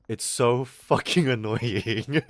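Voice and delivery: slow, stretched words breaking into laughter; real laugh bursting through; laughing complaint. Real, not acted. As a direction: crack up while you complain.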